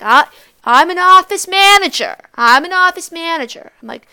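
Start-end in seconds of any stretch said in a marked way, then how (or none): 0.0-2.2 loud, higher, stress on "manager"; boastful aunt. Retold or imitated. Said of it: "she's like". 2.3-3.7 loud, higher, stress on "office"; boastful aunt repeating. Retold or imitated. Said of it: "she's like".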